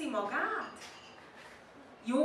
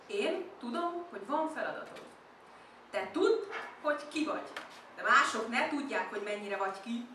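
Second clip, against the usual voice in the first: usual speaking voice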